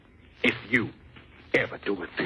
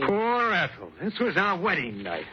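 higher, bitter mocking echo; sarcastic; 9 semitones higher, slower than usual. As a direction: throw her words back scornfully. Husband mocks wife's excuse.

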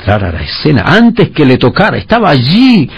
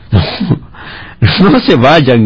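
cry out; 6 semitones higher, much slower than usual. A narrator's reading. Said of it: "gritó Wally".

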